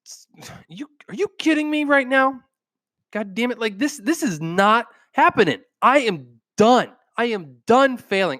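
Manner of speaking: high, exasperated rant; fed up and done with it; frustrated outburst. Retold or imitated. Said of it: "You're like".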